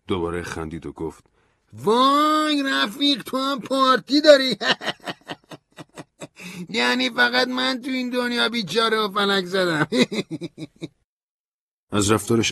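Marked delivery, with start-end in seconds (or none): 1.7-9.9 high, slow, mocking drawl with a laugh; Stanley's jeering voice. A narrator's reading. Stanley teases narrator about his connections, high mocking voice. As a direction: tease him like a bitter drunk.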